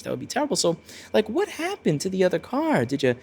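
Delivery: high, soft, sugary mock concern; fake concern. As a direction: play the nosy relative pretending to care. Nosy relative feigning concern.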